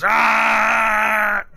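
sharp charging shout; monster battle yell. Acted. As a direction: yell while swinging the pipe. Bugbear attacks with pipe.

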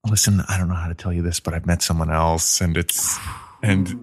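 low, halting retold confession; subdued breakup voice. Young lady ending it with her date, low and reluctant. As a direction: break it gently, pitched well down.

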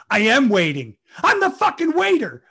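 exasperated retort rising to a yell; loud, high, acted outburst; arguing. Waiter snapping back at Larry in a turnstile argument, exasperated yell. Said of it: "have this argument about it".

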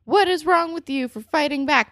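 mock scolding. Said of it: "so we can't be like".

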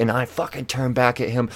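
ordinary delivery

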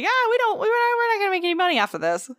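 high, fast mock quote; mock boss voice. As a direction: rattle it off in a high silly voice. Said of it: "he'd be like".

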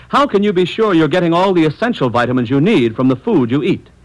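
ordinary delivery

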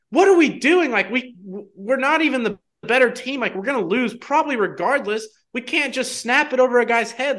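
high-pitched exasperated outburst; freak out. Retold or imitated. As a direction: replay the panic, pitch way up. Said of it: "admittedly do kinda have a freak out".

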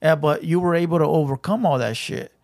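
usual speaking voice

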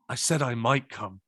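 low, deadpan retort; dry put-down. Retold or imitated. Idol's dry put-down retold.

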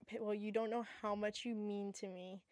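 soft imagined tearful confession; mock tearful. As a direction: whisper it as if choked up. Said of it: "crying and being like".

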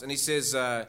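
usual speaking voice

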